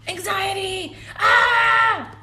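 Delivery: high panicked cry ending in a loud scream; hammed-up hysterical wail; mock anxiety attack. Streamer playing up anxiety on live, high mock scream. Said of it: "I'm freaking out".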